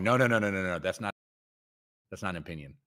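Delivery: ordinary talk, nothing marked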